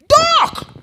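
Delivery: high, loud retold yell; scream. Student at the back yelling at the lecturer, loud high scream. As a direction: shout it across the room from the back. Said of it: "he screamed".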